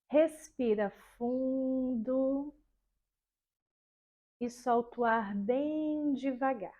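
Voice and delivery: slow, soft, soothing relaxation voice; guided meditation. Shown as a demonstration. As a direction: lull the class into calm, very slowly. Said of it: "E aí você vai começar assim, ó".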